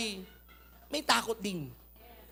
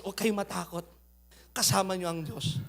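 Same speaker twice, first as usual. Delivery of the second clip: much softer and lower, calming; gentle reassurance; 8 semitones lower, 7 dB quieter than usual. Acted. Moses reassuring the terrified Israelites, soft and low. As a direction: hush them like a leader soothing a crowd. Moses comforts the frightened people.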